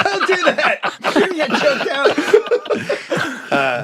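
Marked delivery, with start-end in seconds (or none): 0.0-3.5 high-pitched laughing warning; cackling through a mock threat. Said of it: "like when Dylan Brooks talked back to Jimmy Butler".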